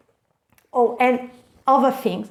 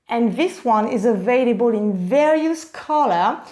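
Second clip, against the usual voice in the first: usual speaking voice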